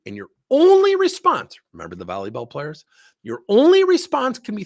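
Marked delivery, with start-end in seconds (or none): 0.1-1.6 "only" shouted high and long; loud, high-pitched stress on "only"; emphatic rule. Retold or imitated. Drives home the one rule. 3.2-4.6 "only" pitched very high and loud; loud, high stretch on "only"; emphatic rule. Retold or imitated. Repeats the one rule, emphatic.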